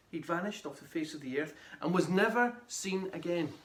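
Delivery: ordinary delivery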